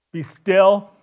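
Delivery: loud firm command; calm the storm with one word. Acted. Jesus commanding the storm, loud and firm. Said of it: "he's like".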